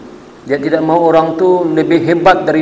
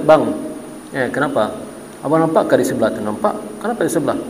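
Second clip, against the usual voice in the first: much softer, lower conspiratorial question; hushed gossip; 3 semitones lower, 7 dB quieter than usual. Retold or imitated. Wife softly gossips to husband about the neighbours, much softer. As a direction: lean in and gossip quietly.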